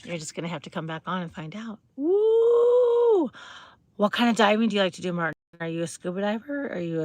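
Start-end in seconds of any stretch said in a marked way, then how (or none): 1.9-3.3 long high whoop of glee; high cheer. Real, not acted. Host teasing viewers about the hair, high whoop. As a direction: whoop it out to the chat.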